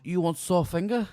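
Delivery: high imitated Chinese accent. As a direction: mimic a thick Chinese accent. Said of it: "very thick Chinese accent".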